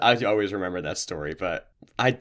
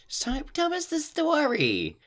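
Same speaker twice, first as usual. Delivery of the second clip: mock child's voice, pitched way up; whining screaming kid; slower than usual. Host mimicking movie kids wanting a story, high whiny kid voice. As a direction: imitate a kid begging in a high, drawn-out whine. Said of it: "kids screaming at the beginning of a movie".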